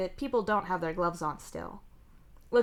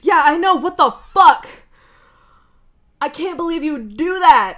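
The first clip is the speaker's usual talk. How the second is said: much higher, loud disbelieving shout; outraged yell; 9 semitones higher, 17 dB louder than usual. Real, not acted. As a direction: yell your disbelief at the betrayal. Chat reacts to stream crash.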